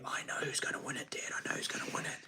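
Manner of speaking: breathless whisper of a secret. Retold or imitated. Father retells his son's secret whisper at the stream. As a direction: whisper it like a kid sharing a secret. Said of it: "he was whispering to me".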